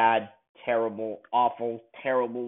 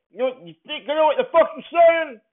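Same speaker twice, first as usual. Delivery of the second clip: high, fast mocking imitation of McClane's line; 11 semitones higher, 3 dB louder than usual. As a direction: parody the hero's clumsy line, sped up. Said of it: "knock somebody out and say".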